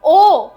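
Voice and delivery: ओ loud, high and held as "oh"; isolated vowel sound. Shown as a demonstration. Teacher demonstrates double-O in door, loud vowel. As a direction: belt out a long ओ. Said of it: "double ओ के चार pronunciations".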